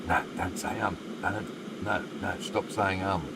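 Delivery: muttered self-scolding. Retold or imitated. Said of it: "looking at myself and saying".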